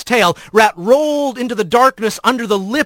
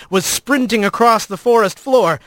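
usual speaking voice

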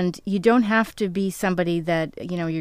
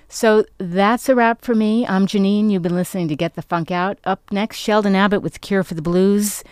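radio announcer; 6 dB louder than usual. Acted. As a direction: wrap up the show like a DJ. Radio sign-off.

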